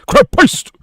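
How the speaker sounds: high, loud "Crab paste!" with a northern "poast"; northern accent. Shown as a demonstration. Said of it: "say it in a northern accent".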